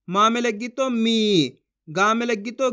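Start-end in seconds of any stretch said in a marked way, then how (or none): 0.9-1.6 मी with a long held ee; drawn-out vowel. Shown as a demonstration. Teacher models मा with बड़ी ई, मी drawn out long. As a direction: stretch the ई in मी. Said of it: "जिस तरीके से मैं खींच खींच के पढ़ रहा हूँ".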